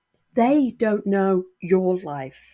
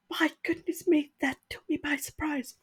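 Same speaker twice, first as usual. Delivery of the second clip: higher and much softer, choked up; fighting back tears, barely voiced; teary and taken aback, softening right down; tearful; 7 semitones higher, 5 dB quieter than usual. Real, not acted. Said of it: "My nose is running now".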